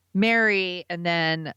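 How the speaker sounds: normal talking voice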